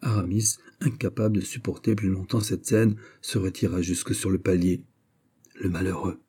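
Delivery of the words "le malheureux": murmured. A narrator's reading. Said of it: "murmura-t-il tout bas".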